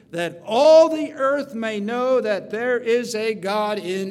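loud, ringing sermon read; preaching at full force. Acted. Preacher proclaiming David's words to the congregation, loud.

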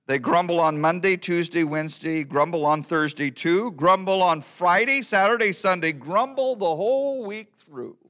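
rising, building run through the weekdays; lively verse recitation. Acted. First take of the weekday verse.

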